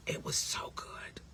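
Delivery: whispered, savoring. Real, not acted. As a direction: breathe it out in a whisper. Whispered aside.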